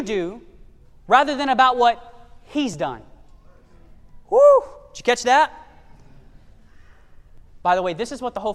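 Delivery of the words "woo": excited woo. Real, not acted. Preacher exclaims after point.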